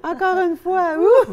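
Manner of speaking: high, loud laughing owl call; laughing hoot. Real, not acted. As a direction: hoot while breaking up laughing. Laughing through the owl exercise. (hululer en éclatant de rire, très aigu)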